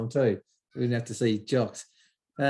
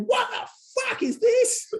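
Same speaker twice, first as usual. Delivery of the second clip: far higher, slow, stretched squeal; outraged squeal; slower than usual. Retold or imitated. Scotty bursting from the toilet, squealing in shock.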